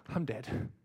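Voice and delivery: breathless whisper. Real, not acted. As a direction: whisper it, spent from laughing. After laughing hard.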